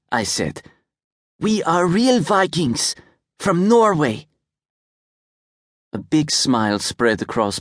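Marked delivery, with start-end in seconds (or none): 1.4-4.3 put-on proud little boy. A narrator's reading. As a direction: puff up like a proud kid. Rune boasts.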